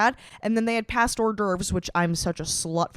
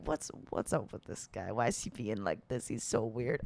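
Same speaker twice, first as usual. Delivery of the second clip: muttered aside; 10 semitones lower, 12 dB quieter than usual. Retold or imitated. Vlogger recalling her own thoughts at the show, low and soft. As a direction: lower and softer inner voice. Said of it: "I'm like".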